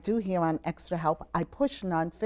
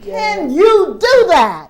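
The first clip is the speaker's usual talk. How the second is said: loud, slow, high hype call; stretched-out crowd-pumping yell; rousing shout; 11 semitones higher, 15 dB louder, slower than usual. Real, not acted. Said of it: "you don't sound like you really can".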